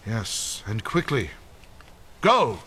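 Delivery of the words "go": short, higher urgent command; urgent order. Acted. Leader urging believers to flee, urgent order. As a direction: send them off with force.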